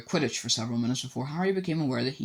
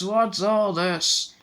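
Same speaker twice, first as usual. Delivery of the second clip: grand showman repeat; 8 semitones higher, 4 dB louder, much slower than usual. A narrator's reading. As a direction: savour the question, still grand. Repeated, a notch softer.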